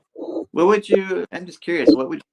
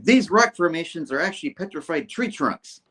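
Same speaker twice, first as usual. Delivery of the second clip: higher, emphatic declaration; hammed-up cameraman; 7 semitones higher than usual. Cameraman lecturing on rock formations, pitched up and emphatic. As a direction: declare it like an eager amateur theorist.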